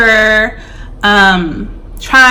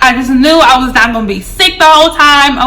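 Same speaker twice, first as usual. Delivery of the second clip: normal talking voice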